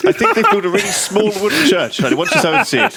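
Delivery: put-on fussy old husband voice; old man voice. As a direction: play a doddery old husband.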